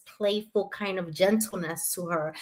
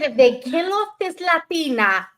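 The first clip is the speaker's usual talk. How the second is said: loud, higher-pitched retold threat; mad ultimatum; 4 semitones higher, 8 dB louder than usual. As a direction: lay down an indignant ultimatum, loudly. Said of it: "I was gonna be mad".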